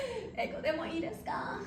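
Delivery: sheepish, laughing through the question; apologetic with a laugh. Shown as a demonstration. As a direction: say it with a sorry little laugh. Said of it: "be sorry face of it".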